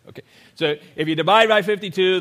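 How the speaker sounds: ordinary delivery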